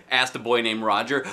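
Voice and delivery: plain reading voice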